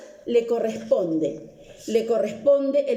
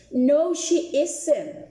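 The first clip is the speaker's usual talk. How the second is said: slow model answer; 3 semitones higher, much slower than usual. Shown as a demonstration. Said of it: "Vuelvo con la pregunta y la pronunciación".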